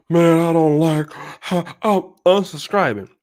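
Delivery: entitled viewer. Retold or imitated. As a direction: imitate a complainer dragging out the words. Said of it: "some of y'all are so entitled".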